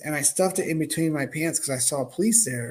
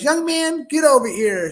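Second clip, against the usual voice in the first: much higher, louder commanding call; bossy biker lady; 10 semitones higher, 6 dB louder than usual. Retold or imitated. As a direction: summon the kid like a tough matron. Biker lady summoning him.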